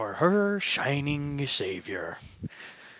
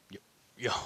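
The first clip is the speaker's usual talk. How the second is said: whisper; 6 semitones lower, 11 dB quieter than usual. Acted. Whispered aside.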